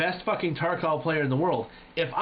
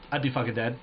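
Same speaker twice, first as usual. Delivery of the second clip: ordinary delivery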